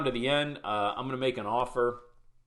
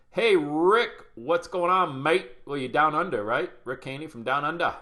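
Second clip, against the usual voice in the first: loud, higher-pitched mock Australian greeting; fake Australian accent; 5 semitones higher, 5 dB louder than usual. Host greets a viewer from Down Under, fake Australian accent. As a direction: hail a mate in put-on Australian. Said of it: "fake Australian accent that I only learned".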